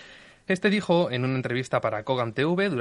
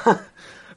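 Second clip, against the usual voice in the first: loud breathless laugh; real laughing fit; helpless laughter; 10 dB louder than usual. Real, not acted. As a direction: keep laughing, unable to stop. Host still laughing.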